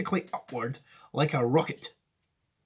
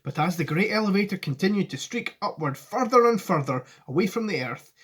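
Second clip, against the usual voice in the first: ordinary delivery, nothing marked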